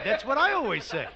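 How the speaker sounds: usual speaking voice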